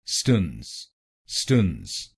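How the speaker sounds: स्टन्स with a short reduced vowel, stns; syllable demo. Shown as a demonstration. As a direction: say स्टन्स clipped, no open a. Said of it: "एसटीएएनसीई को बोलिए".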